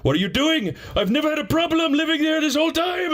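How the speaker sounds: high put-on whiny landlord voice; mock landlord. Landlord whining at tenant, mock high voice.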